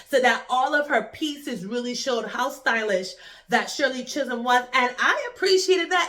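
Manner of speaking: normal talking voice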